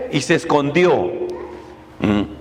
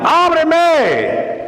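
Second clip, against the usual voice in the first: pleading call; 9 semitones higher than usual. Acted. The beloved calling at the door, a high pleading ábreme.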